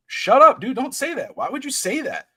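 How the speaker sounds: high-pitched retold disbelief, loud at the start; re-enacted shocked refusal to believe it; disbelieving protest. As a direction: burst out in protest, then plead. Retells reaction to shocking death news.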